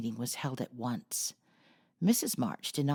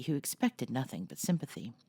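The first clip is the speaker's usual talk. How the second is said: plain reading voice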